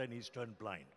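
normal talking voice

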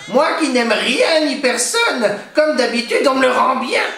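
a cornered hag on the edge of sobbing; despairing witch. A narrator's reading. Witch hiding from the crying baby, high tearful crone voice. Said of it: "elle songea".

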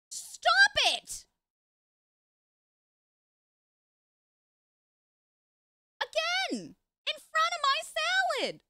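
very high, loud scandalized squawk; outraged shriek. Acted. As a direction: shriek at them like a scandalized auntie. Scolding in-game kissing couple.

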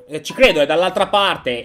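ordinary delivery

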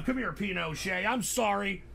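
normal talking voice

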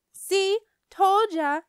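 loud, high I-told-you-so jeer; gloating taunt. A narrator's reading. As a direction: rub it in like a mean kid. Mean Jim gloats at her.

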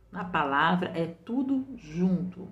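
usual speaking voice

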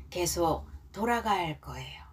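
whispered translation, very soft. Real, not acted. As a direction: breathe the words out softly. (속삭이듯이)

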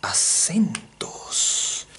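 slow secretive whisper. Acted. Teacher to the class, slow hushed whisper. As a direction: let the words drop to a hush.

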